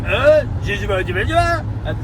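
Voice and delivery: badly faked French babble; mock French accent. Imitating Quebec restaurant staff who don't understand, in a mock French accent. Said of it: "that's my French accent".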